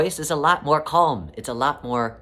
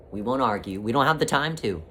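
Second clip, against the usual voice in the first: normal talking voice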